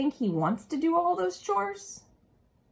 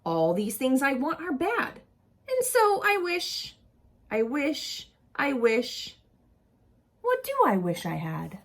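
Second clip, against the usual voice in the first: lively storybook read-aloud voice; animated picture-book reading; reading to children; 2 semitones lower, 4 dB louder than usual. Acted. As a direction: read it to a group of kids with expression.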